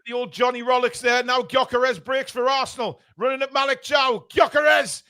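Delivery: quick play-by-play call; live commentary. Real, not acted.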